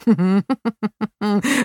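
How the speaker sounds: put-on rising fit of boyish laughter; boy cracking up at Paula's rant; burst of laughter. A narrator's reading. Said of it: "Max bekam einen totalen Lachanfall".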